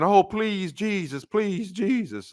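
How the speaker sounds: high, drawn-out mock pleading wail; hammed-up begging of a grieving mourner. Mourners wailing at funeral.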